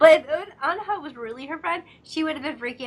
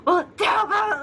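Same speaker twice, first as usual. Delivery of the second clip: jealous, louder and higher "Well, tell him"; 8 semitones higher, 5 dB louder, slower than usual. Retold or imitated. Boy jealous of her oppa, mock shout. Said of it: "he gets so jealous".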